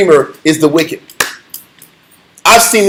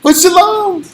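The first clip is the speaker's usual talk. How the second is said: higher, sweet mock shalom; fake pious greeting; 8 semitones higher than usual. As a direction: put on a saintly smile. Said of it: "evil as hell inside".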